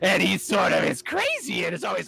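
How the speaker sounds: very high-pitched crazy supervillain; hammed-up, unhinged evil genius voice; Doctor Drool. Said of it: "He talks like this".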